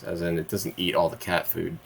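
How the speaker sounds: ordinary talk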